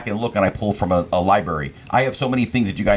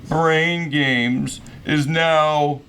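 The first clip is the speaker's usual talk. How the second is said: slow, drawn-out TV announcer; 3 semitones higher, much slower than usual. Retold or imitated. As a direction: boom it out like a show ending. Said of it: "'cause it went".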